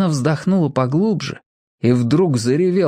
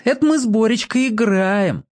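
mock bully; 8 semitones higher than usual. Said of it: "приговаривает".

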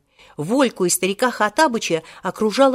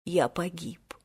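soft hopeless whisper; hushed, doomed whisper; despairing whisper; 3 semitones lower, 9 dB quieter than usual. A narrator's reading. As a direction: whisper it as if all is lost. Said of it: "тихо шептал Волька".

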